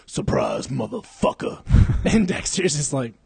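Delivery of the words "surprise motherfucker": menacing low movie-villain catchphrase; Sergeant Dokes. Retold or imitated. Host acting out Dexter's Everglades scene, low Dokes impression. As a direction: drop way down on the first word. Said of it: "he comes up and he's like".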